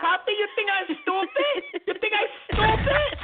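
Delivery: high, far put-on voice, indignant. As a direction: play the fiery girlfriend scolding her man.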